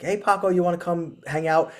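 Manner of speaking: lightly acted coaxing of a pet; coaxing dog talk. Owner inviting Paco to the couch, lightly coaxing.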